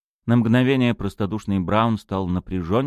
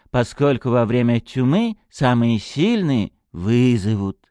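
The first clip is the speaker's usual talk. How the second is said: put-on childlike lisping, slow and raised; childish lisp; 7 semitones higher, 5 dB louder than usual. A narrator's reading. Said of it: "по-детски шепеляво".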